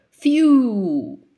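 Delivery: loud sigh of relief, breath out; relieved phew. A narrator's reading. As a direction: blow out the worry.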